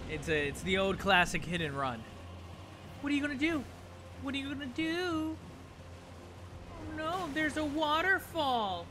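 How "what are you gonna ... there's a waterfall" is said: much higher, playful teasing; high taunting voice. Pretend. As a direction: tease in a high put-on voice. Mock taunting while fleeing in game.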